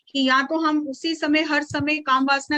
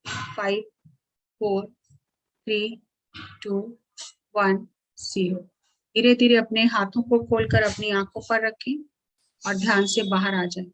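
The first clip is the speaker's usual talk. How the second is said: guided meditation countdown; 3 semitones lower than usual. Acted. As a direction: ease the listeners out of meditation.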